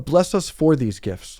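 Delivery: slow, stressing "for" in "Bless us for these gifts"; wrong version. Shown as a demonstration. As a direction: draw out "for" slowly.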